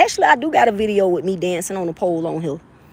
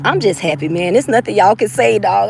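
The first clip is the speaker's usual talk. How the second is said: ordinary talk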